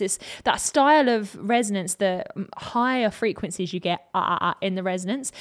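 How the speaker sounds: ordinary talk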